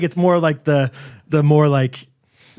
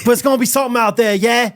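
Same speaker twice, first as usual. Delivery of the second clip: hammed-up British-Australian accent, higher; improvised British-Australian accent; 4 semitones higher than usual. Co-host asks for Scottish, so improvised wobbly British-Australian accent. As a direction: loose British accent sliding toward Australian. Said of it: "I'm just gonna do something over there".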